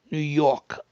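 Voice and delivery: New York accent, dropping the R in "York". Shown as a demonstration. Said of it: "without the R".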